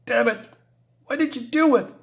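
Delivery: high, distressed cry of frustration; acted anguished outburst. Boy finds his friend went into the hole, anguished outburst.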